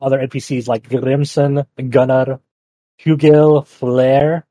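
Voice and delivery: ordinary talk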